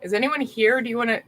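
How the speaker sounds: ordinary delivery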